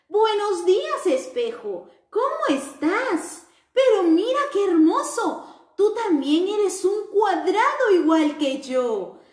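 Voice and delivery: high, bright-eyed little square voice; sing-song greeting for children; cheerful square character. Acted. The square happily greets its friends in a high cheerful voice.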